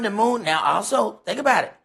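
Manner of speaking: normal talking voice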